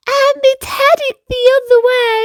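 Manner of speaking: little brother relieved by the storm; relieved little boy. Acted. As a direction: let the fear melt out. Kingston relieved, high.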